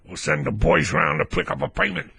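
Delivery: put-on heavy's voice; mock debt-collector threat. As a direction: play the enforcer threatening to send the lads. Voicing the brethren's payment threat.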